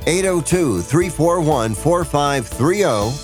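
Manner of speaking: ordinary talk, nothing marked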